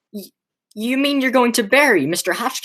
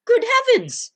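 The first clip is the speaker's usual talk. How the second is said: much higher, a panicked yelp; shocked outburst. Acted. Nephew recoiling at his aunts' murders, yelping high. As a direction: jump up in pitch in sheer alarm.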